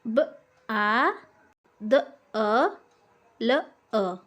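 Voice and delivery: ब, आ, द, अ, ल, अ said one sound at a time with pauses; each sound of बादल said on its own; sounds split apart. Shown as a demonstration. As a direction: break बादल into separate sounds. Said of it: "जैसे बादल".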